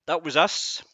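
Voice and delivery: ordinary delivery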